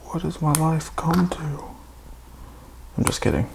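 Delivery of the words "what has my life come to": hammed-up whispered self-pity; despairing whisper. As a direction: breathe it like a sad joke. Mock despair about own video.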